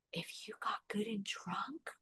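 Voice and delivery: slow dark whisper to herself; inner whisper. Retold or imitated. Woman recalling her inner voice at her lowest, whispering. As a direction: whisper the thought under your breath. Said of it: "inside my head, I'm like".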